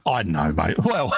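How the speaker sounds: ordinary delivery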